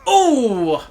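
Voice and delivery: high, loud surprised ooh. Real, not acted. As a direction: gasp it out, startled. Reacting to alien kill.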